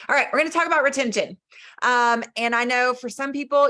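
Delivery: upbeat fresh start after a tech hiccup; energetic restart. Real, not acted. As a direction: relaunch the topic as if starting over with gusto. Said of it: "we're just gonna roll with it".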